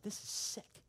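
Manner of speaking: hushed, breathy whisper of disgust. Retold or imitated. Atheist friend whispering to pastor in church, disgusted. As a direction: whisper it like it's gross.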